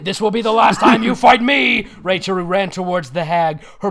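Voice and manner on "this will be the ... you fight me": loud, hammed-up heroic challenge; defiant battle cry. A narrator's reading. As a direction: declare it like a cartoon heroine. Heroine defies the witch in story.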